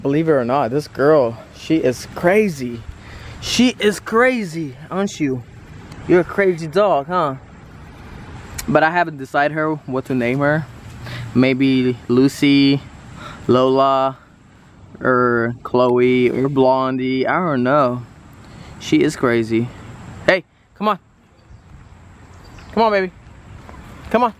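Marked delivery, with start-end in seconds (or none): 3.4-7.4 high cooing pet voice; baby talk to puppy. Acted. Teasing the eight-week puppy, high doting coo. 20.3-24.3 calling puppy. Real, not acted.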